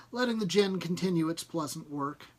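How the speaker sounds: ordinary delivery, nothing marked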